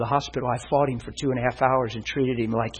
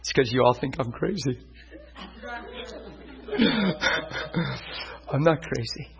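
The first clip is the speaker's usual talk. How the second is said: ordinary talk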